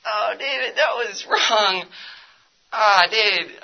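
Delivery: a sorrowful voice calling his name; God grieving over him. Retold or imitated. God rebuking David for cheating, sorrowful and close to tears. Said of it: "God is just burdening my heart".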